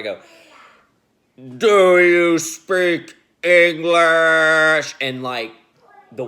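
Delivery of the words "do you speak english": loud, slow, booming voice that fills the room; menacing dream voice. Retold or imitated. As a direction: drag each word out like a threat that isn't you. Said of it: "it sounds way more menacing than that".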